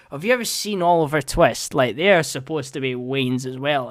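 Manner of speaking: usual speaking voice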